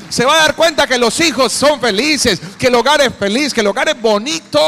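shouted sermon climax; preaching climax. Real, not acted. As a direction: cry it out like the peak of a sermon.

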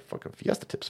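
quiet throwaway whisper; whispered aside. Real, not acted. Host thanking tippers in a whisper.

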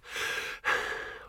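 breathless exhale; heavy gasp for breath. A narrator's reading. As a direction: gasp after climbing. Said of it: "fought for breath".

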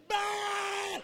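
high drawn-out yell; shouting. Acted. Prophets of Baal calling their god, shouting. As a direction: cry out to a distant god. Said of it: "They started shouting".

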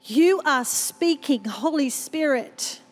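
slow, hushed prayer voice. Acted. Preacher praying over the congregation, slow and reverent. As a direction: pray over the room, slowly.

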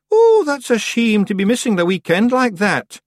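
loud, high Scottish pixie; cheerful wee Scottish Feegle. A narrator's reading.

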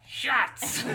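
high, loud squeaky goblin shout. Acted. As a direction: yell it like a rowdy goblin. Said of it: "another smaller goblin voice".

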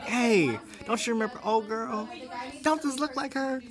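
high, loud hyped-up call to a friend; excited. Retold or imitated. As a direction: squeal it out like you spotted something. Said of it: "but I'm gonna be like".

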